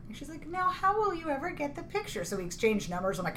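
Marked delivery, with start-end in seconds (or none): 0.5-2.2 flirty voice. Retold or imitated.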